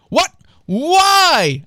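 high, loud drawn-out WHY; dismayed protest. A narrator's reading. Trent told parents will be called, loud high protest. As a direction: wail it in mock dismay.

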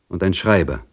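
ordinary delivery

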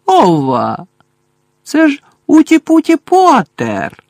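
high cackling poltergeist taunt; Peeves squawking. A narrator's reading. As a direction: crow at Harry like a gleeful poltergeist. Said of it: "крякнув Півз".

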